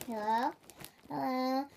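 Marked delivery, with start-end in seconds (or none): none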